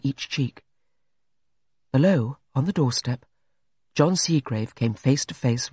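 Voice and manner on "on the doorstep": ordinary delivery, nothing marked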